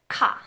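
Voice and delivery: ka said with a strong puff of air; aspirated ka. Shown as a demonstration. Teacher repeating the aspirated ka for students. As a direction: release ka with a burst of breath. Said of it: "So that should be easier for most people".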